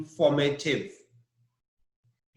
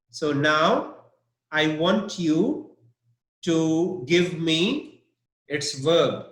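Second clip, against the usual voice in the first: ordinary delivery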